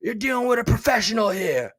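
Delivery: high whispered Beetlejuice line; Beetlejuice impression. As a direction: hiss it like a showman ghost. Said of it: "remember Beetlejuice?".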